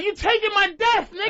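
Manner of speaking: high-pitched indignant Freddy, played for laughs; mock anger. Freddy confronts Jason over stolen kills, mock angry. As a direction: act out an outraged complaint. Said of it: "Freddy got mad".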